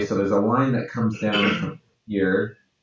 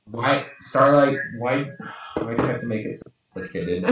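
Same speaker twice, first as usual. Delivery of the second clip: raised pitch, playful whine of complaint; playfully exasperated, higher than usual; mock exasperated plea; 5 semitones higher, 4 dB louder than usual. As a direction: plead in mock despair. Mock complaint to drawn character.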